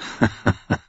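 short unvoiced chuckle; soft laugh. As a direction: breathe a quick laugh. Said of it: "Er lachte".

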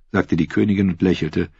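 plain reading voice

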